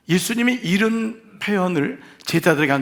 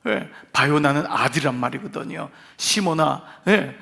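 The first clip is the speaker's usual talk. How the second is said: usual speaking voice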